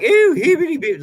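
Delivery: high, loud mock rage in full accent; mock raging accent. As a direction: blow up in a hammed-up New York rant. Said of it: "the accent is in full".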